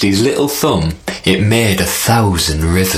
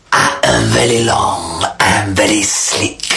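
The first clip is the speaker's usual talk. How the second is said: snake. Acted. Snake answers.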